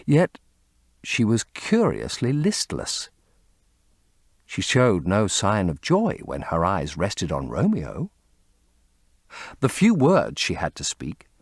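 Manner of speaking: ordinary delivery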